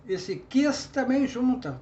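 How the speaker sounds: ordinary delivery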